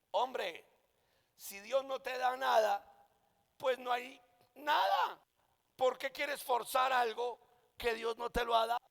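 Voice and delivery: emphatic preacher's rebuke, high and loud; exasperated scolding. Real, not acted. Scolds preachers who force ministry.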